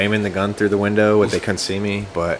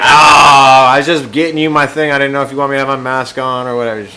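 flustered; 5 semitones higher, 8 dB louder than usual. Retold or imitated. Retelling his flustered reply to cop.

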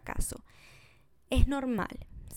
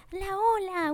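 much higher cheering call; mock cheer; 10 semitones higher, slower than usual. Imitating coworkers doing the wave at a drill, mock cheer.

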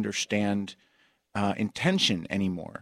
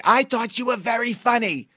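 put-on much higher, louder old-lady voice; 13 semitones higher, 6 dB louder than usual. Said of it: "And they'll say".

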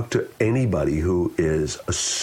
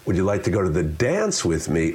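"dance" punched with a jump in pitch; stress on DANCE. Shown as a demonstration. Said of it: "The emphasis on each word".

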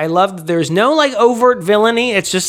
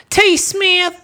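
high silly voice; 11 semitones higher than usual. Pretend. Silly voiced patron names.

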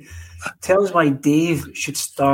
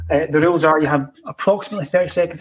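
ordinary talk, nothing marked

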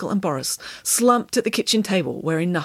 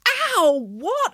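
high yelp, then question; pained surprise; 13 semitones higher than usual. A narrator's reading. Jogger struck by a hairbrush, high startled yelp. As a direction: yelp as if hit.

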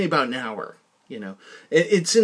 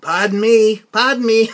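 loud, higher-pitched Massachusetts accent, R dropped; 8 semitones higher, 11 dB louder than usual. Shown as a demonstration. Woman hailing the dog walker from her driveway, Massachusetts accent. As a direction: call it out in a heavy Massachusetts accent. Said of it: "you want me to do a Massachusetts accent".

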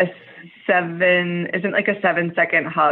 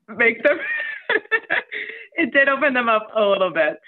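laughing through the words, high at first; 7 semitones higher than usual. Real, not acted. Confessing a hug try that half worked, laughing through it. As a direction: giggle as you admit it half worked.

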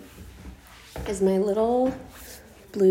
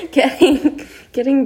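laughing; 7 semitones higher, 7 dB louder than usual. Real, not acted. Laughs mid sentence.

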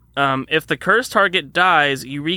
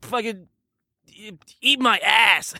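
shouting character; 5 semitones higher, slower than usual. Acted. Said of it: "So he's like".

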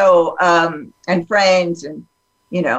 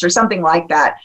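ordinary talk, nothing marked

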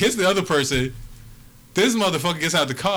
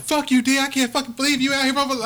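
higher, lightly voiced furious girlfriend; mock angry girlfriend; 10 semitones higher than usual. Girlfriend yelling at him for being out with other girls, lightly mocked. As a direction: play her up as she storms out of the car.

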